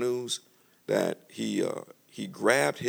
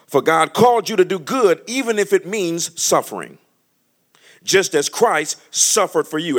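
higher and louder preaching build; 8 semitones higher, 8 dB louder than usual. Acted. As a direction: rise into the preaching. Preaching at full force.

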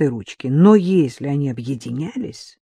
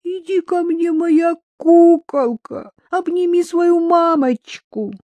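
high, slow cooing in a put-on voice; drunken baby talk; 12 semitones higher, slower than usual. A narrator's reading. Drunk mother calls daughter for a hug, cooing baby talk. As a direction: coo drunkenly, dragging the words. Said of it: "пьяно засюсюкала".

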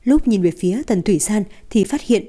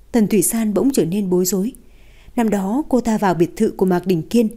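usual speaking voice

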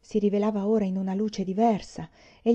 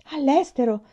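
higher, louder burst of glad surprise; joyful exclamation; 6 semitones higher, 6 dB louder than usual. A narrator's reading. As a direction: exclaim it with joy. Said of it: "esclamò con gioia Peonia".

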